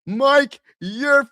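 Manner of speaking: high, loud pretend firing; mock angry boss. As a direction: shout like a boss sacking his coach, hammed up. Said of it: "I'll probably be fired the first week".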